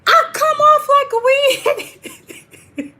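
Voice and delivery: very high, slow, laughing through the words. Real, not acted. Streamer laughing at how she comes across, high and slow. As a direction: crack up mid-line.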